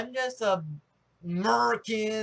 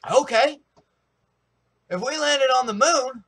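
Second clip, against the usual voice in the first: loud, higher, spitting out the words; angry challenge; 7 semitones higher, 10 dB louder than usual. Retold or imitated. Online opponent snapping a challenge, loud and heated. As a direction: fire it back at him in a heated argument. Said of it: "he spits out".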